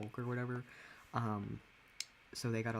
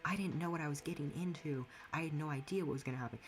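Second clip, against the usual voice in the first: higher-pitched mock complaint; mocking quote; 5 semitones higher than usual. Streamer imitates those she argues against, mocking. Mocking people who regret transitioning.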